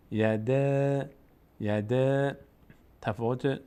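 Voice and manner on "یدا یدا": "یده" slowly stretched on a thin front A; thin, light reading. Shown as a demonstration. Teacher repeating for students, "یده" with thin long A. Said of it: "باریک خواندن و پر خواندن رو دقت بکنید".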